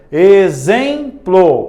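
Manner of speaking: Exemplo slow, the xem leaned on; stress in exemplo. Shown as a demonstration. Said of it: "uma paroxítona". (Exemplo com ênfase no xem)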